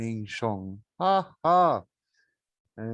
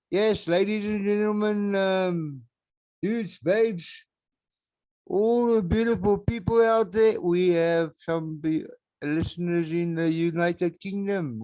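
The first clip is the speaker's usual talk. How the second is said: big, high showman's announcement; announcer voice; 7 semitones higher, 4 dB louder than usual. Acted. Podcaster hyping up his UK listeners, in a high, loud announcer voice. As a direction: hype it up like a stage MC.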